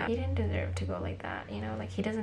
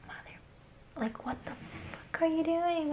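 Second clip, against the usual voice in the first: hushed cussing; 11 semitones higher, 7 dB quieter than usual. Retold or imitated. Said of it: "So I was just cussing in English".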